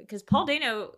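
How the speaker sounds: normal talking voice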